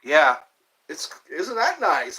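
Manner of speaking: ordinary talk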